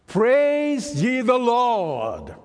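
slow, high, loud proclamation; preaching proclamation. Acted. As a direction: lift it up like a full-force preacher's cry. Proclaims at full force.

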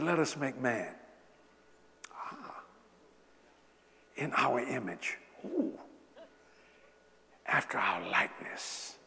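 soft, slow, awed reading; hushed reading. Acted. Preacher reading Genesis creation of man in a soft awed voice. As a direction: let the words land in hushed awe.

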